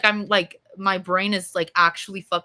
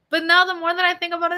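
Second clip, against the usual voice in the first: ordinary talk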